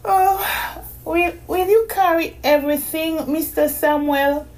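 put-on high, sleepy girl voice; a tired little girl asking sweetly; young girl voice. A narrator's reading.

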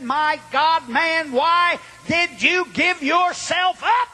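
high, slow, emphatic disbelief; astonished demand. Retold or imitated. District attorney interrogating the man who surrendered, astonished demand. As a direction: drag out the question, climbing in pitch.

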